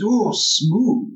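throaty, approving ooh; appreciative huff. Acted. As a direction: savour it, rising high on the ooh. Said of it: "appreciative huff in my throat".

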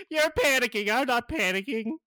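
high, loud denial of his own panic; Gizmo in denial. Acted. Gizmo snaps at Cyborg that he isn't panicking, high loud voice. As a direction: insist shrilly you're fine while tearing up.

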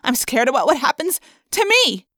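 high, loud, frightened rant; upset outburst. A narrator's reading. Rachel snapping at Marco that she is scared, upset outburst. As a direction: let the fear burst out as anger.

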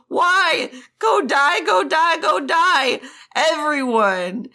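high-pitched, grief-driven tirade; losing his mind. Acted. Jiang Cheng blaming Wei Wuxian for the deaths, losing his mind. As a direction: build from accusation to frantic high outburst. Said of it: "Jiang Cheng had lost his mind".

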